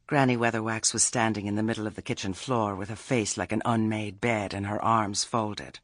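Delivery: ordinary delivery, nothing marked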